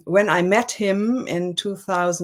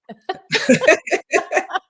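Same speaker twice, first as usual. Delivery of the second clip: bright high ringing laugh; 13 semitones higher, 4 dB louder than usual. Real, not acted. Guest finishing her story about seven million trees, high laugh.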